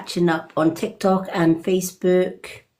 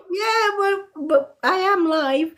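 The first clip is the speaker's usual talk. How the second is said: retold protest; 10 semitones higher than usual. Acts out own protesting reply.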